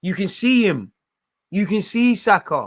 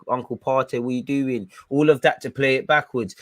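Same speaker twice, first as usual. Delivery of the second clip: normal talking voice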